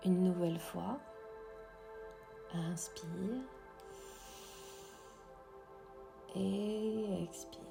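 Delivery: soft, slow whispered meditation voice; guided meditation. Acted. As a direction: guide the breathing gently, very slowly. Leads a breathing meditation.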